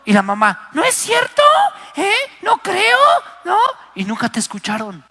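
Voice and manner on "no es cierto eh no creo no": high falsetto mock mom, brushing it off; dismissive mother. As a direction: squeak out a mother who won't believe her child. Mamá desestima lo que cuenta el hijo. (madre incrédula con voz aguda y burlona)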